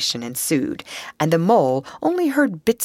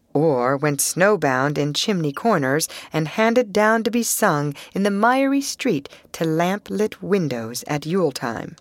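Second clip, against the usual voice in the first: plain reading voice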